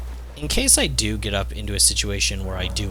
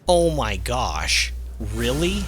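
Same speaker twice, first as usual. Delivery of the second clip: amazed; 4 semitones higher, slower than usual. Real, not acted. Amazed by weapon's attack.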